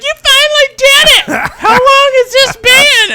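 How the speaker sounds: very high, slow, shouted mom voice; cheering mom. Retold or imitated. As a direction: shout like a thrilled mother. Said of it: "She's like".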